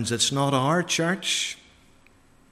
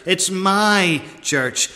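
"my" slowed and leaned on hard; slow with heavy weight on "my"; stress on my; 3 semitones higher, slower than usual. Acted. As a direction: say "my" as if in capital letters. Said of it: "as if he puts it in capital letters".

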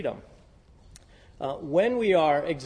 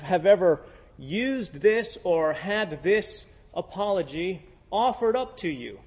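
ordinary talk

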